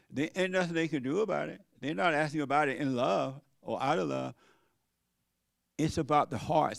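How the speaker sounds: ordinary talk, nothing marked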